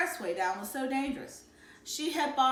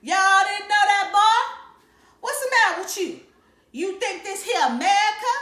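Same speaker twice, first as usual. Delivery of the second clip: loud, higher hammed-up Southern hillbilly accent; playful exaggerated Southern hillbilly accent, loud and high; 11 semitones higher, 12 dB louder than usual. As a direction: bellow it like a mocking Southern hillbilly. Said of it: "say some more Southern style stuff".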